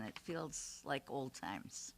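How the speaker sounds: slow, soft and wistful. Real, not acted. Nostalgic remark to the room.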